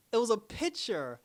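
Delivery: normal talking voice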